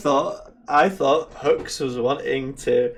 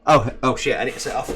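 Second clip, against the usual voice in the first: ordinary talk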